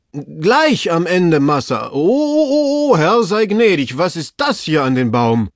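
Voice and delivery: slow report bursting into high wails; loud frightened ohs, then falling question; horrified servant. Acted. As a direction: cry out in alarm at a shocking sight. Servant horrified by his find.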